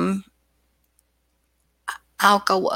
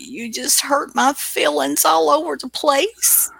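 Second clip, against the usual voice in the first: mock hurt; 6 semitones higher than usual. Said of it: "looks like you deserve an Academy Award".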